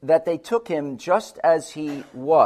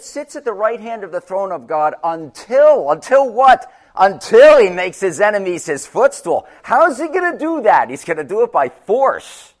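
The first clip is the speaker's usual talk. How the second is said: louder and higher, building fervor; full-force sermon climb; rising preaching; 6 semitones higher, 8 dB louder than usual. Acted. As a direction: preach it with mounting power.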